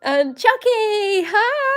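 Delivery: high, loud, drawn-out hello; excited greeting. Real, not acted. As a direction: sing out a delighted hi. Greeting a viewer in chat.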